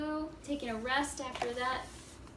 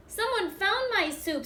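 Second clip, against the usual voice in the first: put-on much higher, squeaky Baby Bear; 8 semitones higher, 7 dB louder than usual. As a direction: squeak it out like a tiny cub. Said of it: "in her high squeaky voice".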